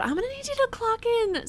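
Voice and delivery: high-pitched mocking boss voice; mock callous boss. Streamer imitating a boss to grieving worker, mocking.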